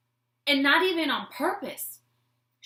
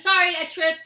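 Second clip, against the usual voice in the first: retold apology; 7 semitones higher, 8 dB louder than usual. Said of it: "And I said".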